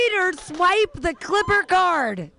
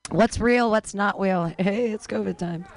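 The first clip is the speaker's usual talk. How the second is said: ordinary talk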